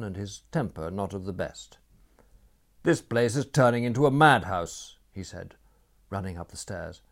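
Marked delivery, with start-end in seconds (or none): 2.8-5.0 angry. A narrator's reading. Bad-tempered Franz arriving.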